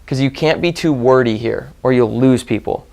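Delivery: ordinary delivery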